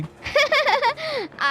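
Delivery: high flattered giggle; coy giggle. Acted. Girl giggling coyly at the young man's compliment.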